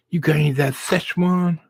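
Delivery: high, loud eager question; eager ask. Retold or imitated. Father asks drive-thru lady for Szechuan sauce, eager and high. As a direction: lean in hungry for the sauce.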